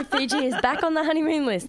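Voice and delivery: high, loud, laughing announcement; excited laughing. Real, not acted. Repeating news with a laugh.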